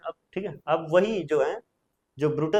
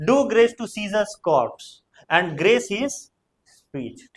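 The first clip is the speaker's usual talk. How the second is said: very slow, louder declaiming; slow recitation; 4 semitones higher, 4 dB louder, much slower than usual. Shown as a demonstration. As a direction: stretch each word slowly and loudly. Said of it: "बेवकूफ".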